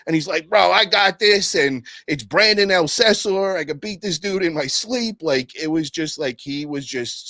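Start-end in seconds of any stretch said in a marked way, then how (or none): 0.5-5.2 higher, louder, slowed swagger; cocky boast. Retold or imitated. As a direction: brush off the worry, full of yourself. Friend's confident boast retold.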